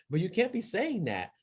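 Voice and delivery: high retold reproach after a laugh; indignant scolding. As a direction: lift the pitch in disbelieving protest. Retelling his protest to referee.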